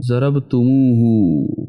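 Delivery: जरबतुमुहु said slowly as one linked word, each syllable drawn out; slow joined demo. Shown as a demonstration. Teacher showing students how to join जरबतुमुहु, slow and drawn out. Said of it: "मिलाकर पढ़ने के लिए".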